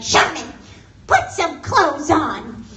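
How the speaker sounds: loud slow nagging mother; scolding mom. Retold or imitated. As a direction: scold the husband firmly. Mom yelling at dad.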